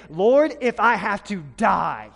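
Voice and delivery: slow and loud, swearing loyalty; earnest vow. Retold or imitated. Peter to Jesus at supper, loudly vowing. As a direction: promise it with full force. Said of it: "And Peter said".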